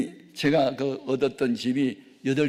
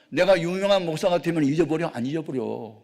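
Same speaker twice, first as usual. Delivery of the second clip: usual speaking voice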